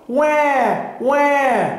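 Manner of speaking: high, drawn-out imitated wail; baby seal cry. A narrator's reading. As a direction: call out "wah" like a baby seal. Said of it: "the baby seal cried".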